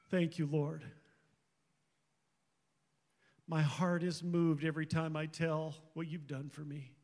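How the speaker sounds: soft, halting prayer spoken to God; heartfelt prayer. Real, not acted. Pastor thanks God for his parents, in a soft, tender prayer. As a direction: pray quietly, as if alone with God. Speaker prays aloud after testimony.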